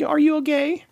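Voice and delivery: very high, squeaky mock concern; mock worried fan. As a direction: overdo a fretting fan. Mocking worried listeners.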